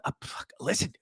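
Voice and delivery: hushed urgent whisper into the phone; frightened caller whispering; whispered panic. Acted. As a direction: whisper so the neighbor won't hear. Acting the neighbor's 911 call.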